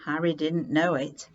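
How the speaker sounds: usual speaking voice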